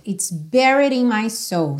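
"buried" said like "berried", with an e sound; buried said right. Shown as a demonstration. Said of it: "a pronúncia dela é buried".

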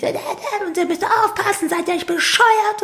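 high, slow mock scolding; hammed-up angry dad tearing into the boys; father snapping at the boys, high and slow. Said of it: "hat die Jungs zusammengestaucht".

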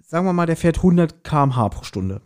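ordinary delivery

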